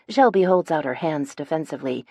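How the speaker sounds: ordinary delivery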